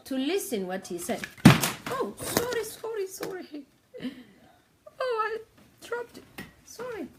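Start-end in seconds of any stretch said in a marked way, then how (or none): 1.9-7.1 high, flustered sorry after dropping something; startled apology. Real, not acted. Streamer drops her iPad, flustered high apologies to viewers. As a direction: yelp out the apologies, caught off guard, voice jumping up high.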